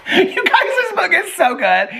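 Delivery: high and loud, laughing out the words. Real, not acted. Telling viewers the book is good, loud and laughing. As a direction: shout it through the giggles.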